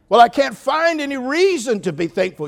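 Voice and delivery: loud high whining protest; mock whine. Pastor voices a congregant whining, high and loud. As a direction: whine like a sulking churchgoer. Voicing a complaining listener.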